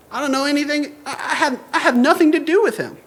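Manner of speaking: mock Peter scrambling to deny it; defensive denial. Peter denying Jesus to a bystander, defensive.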